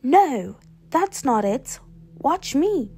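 impatient storybook shout. A narrator's reading. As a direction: call out loudly to take over. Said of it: "shouted Beatrice".

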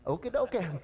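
a lightly imitated comedian's meek assent; Tim Conway. Churchgoer giving in to fishing, lightly imitated comic voice. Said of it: "We're like Tim Conway".

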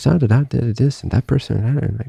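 high, singsong mock rambling; mimicked endless chatter. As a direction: imitate someone constantly reeling off stories. Mimics her constant victim stories.

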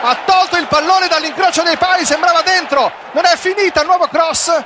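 high breathless shouting play-by-play; breathless italian goal commentary. Real, not acted. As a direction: call the save at full pitch, breathless.

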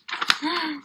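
quiet staged gasp of wonder; a little gasp to build excitement; mock gasp. Teacher teases the last shape for the children, quiet mock gasp.

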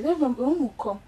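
normal talking voice

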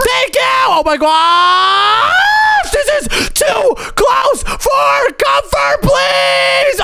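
high, drawn-out panicked shout; panicked yelling. Real, not acted. Gamer to friends as the drop gets too close, panicked yelling. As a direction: stretch it out in high-pitched alarm.

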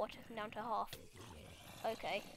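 ordinary delivery

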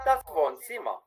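normal quick speed, falling tune; everyday speed; quick natural take. Shown as a demonstration. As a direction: rattle it off the way natives say it. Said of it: "y la otra vez rapidito".

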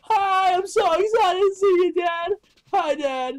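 high, loud squeal of hi dad; overexcited greeting. Acted. Mae greets her dad at the door, overexcited squeal. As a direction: burst with over-the-top joy.

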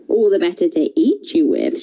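usual speaking voice

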